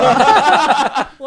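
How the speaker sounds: high, loud cackle; real burst of laughter. Real, not acted. Guest cracking up over who wrote the question, real laughter.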